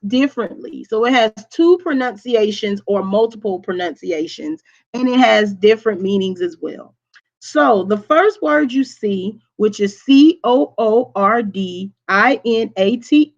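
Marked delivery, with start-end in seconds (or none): none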